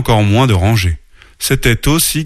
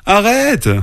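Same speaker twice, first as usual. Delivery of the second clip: high cry; 13 semitones higher than usual. A narrator's reading. Little sister wobbling on the tree trunk, crying out. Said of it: "cria petite sœur".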